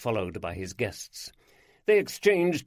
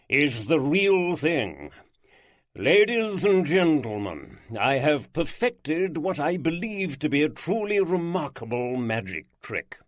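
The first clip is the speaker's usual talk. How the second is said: grand showman lord; 4 semitones higher, 4 dB louder than usual. Acted. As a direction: unveil himself like a stage magician.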